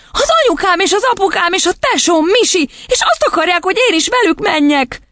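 put-on very high childlike voice; squeaky, agitated explaining; distressed little girl. A narrator's reading. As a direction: pour it out like an upset child.

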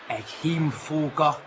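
low, slow, weary-soft declaration; quiet pride. Acted. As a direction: say it slowly and low, with dignity. Wounded son refuses mother's care.

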